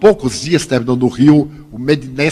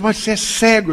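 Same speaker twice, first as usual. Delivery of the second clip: higher incredulous question, mock astonishment; retold disbelief; 6 semitones higher than usual. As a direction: imitate a stranger taken aback. Imitates the man's startled question.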